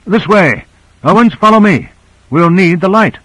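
high, urgent call to follow; calling out orders. A narrator's reading. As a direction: call the crew after you. Leader calling crew to follow.

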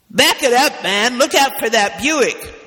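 loud, high shout at a driver; yelling. Acted.

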